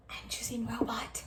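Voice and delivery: whispered secret to the class. Acted. Secretive aside to kids.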